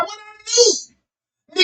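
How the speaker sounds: very high, soft, drawn-out plea; hammed-up high, slow whine; mock innocent protest. Acts himself protesting to cop.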